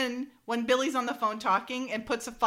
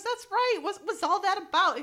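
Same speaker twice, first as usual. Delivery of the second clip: much higher, eager mock gossip; high gossipy question; 10 semitones higher, 3 dB louder than usual. Woman pressing Francine for gossip, high and eager. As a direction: ask it high and nosy.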